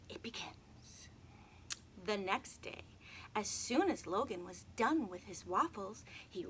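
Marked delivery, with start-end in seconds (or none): 0.1-1.1 hushed, slow whisper; a slow whisper to begin the tale; spooky whisper. Acted. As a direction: breathe it out like a secret. Dramatic story opening.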